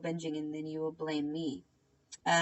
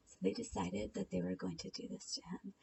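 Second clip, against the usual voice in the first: hushed whisper; conspiratorial whisper to viewers; whispered aside; 10 dB quieter than usual. Real, not acted. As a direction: lean in and whisper it. Secretive aside.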